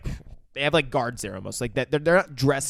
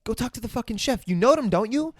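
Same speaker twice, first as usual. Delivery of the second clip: higher, urgent prodding; pressing demand; 7 semitones higher, faster than usual. Retold or imitated. Assistant pressing Sid to see the chef, urgent demand. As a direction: push him to go and act. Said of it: "Sid from Ice Age's assistant says".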